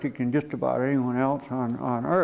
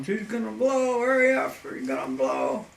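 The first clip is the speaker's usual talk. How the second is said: high-pitched yelling without real volume; mock a panicked warning, high then dropping; imitated panicked yell; 7 semitones higher than usual. Pilot Gene warns that crashed helicopter will blow, imitated panicked yell. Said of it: "And Gene's yelling".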